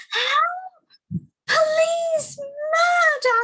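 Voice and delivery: very high, shouted cries for help; terrified scream. A narrator's reading. Mister Jelly, a leaf falls on him, very high terrified shout. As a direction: yell for the police in panic. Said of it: "he screamed".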